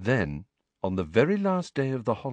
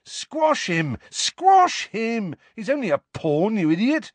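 much higher, eager shouts at the chess piece; enthusiastic egging on; 14 semitones higher, 4 dB louder than usual. A narrator's reading. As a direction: cheer your castle on like a fan. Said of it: "egging it on enthusiastically".

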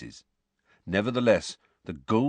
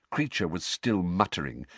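plain reading voice

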